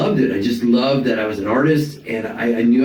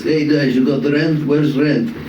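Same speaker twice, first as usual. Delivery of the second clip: put-on light Russian accent; a hint of a Russian landlord asking for rent; Boris, Russian landlord; 3 semitones higher than usual. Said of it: "with a Russian landlord, literally named Boris".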